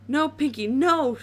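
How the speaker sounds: a pony begging in terror, loud; screaming. A narrator's reading. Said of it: "Dash screamed".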